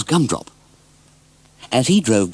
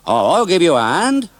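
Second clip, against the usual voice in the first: higher, louder friendly farmer voice; helpful farmer; 7 semitones higher, 4 dB louder than usual. Acted. Farmer Hearn offering Bill help, higher friendly voice. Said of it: "he said".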